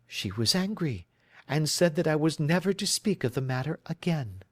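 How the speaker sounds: a worried bride telling her story; anxious young woman. Acted. Client answers.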